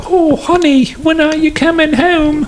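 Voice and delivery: very high falsetto wife voice; mock a wife calling sweetly in falsetto; slow, squeaky put-on wife; imitated wife. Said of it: "His wife was like".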